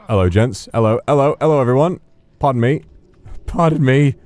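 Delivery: a fussy gentleman begging pardon; polite English gent. Acted. Streamer apologising to strangers in a high gentleman voice.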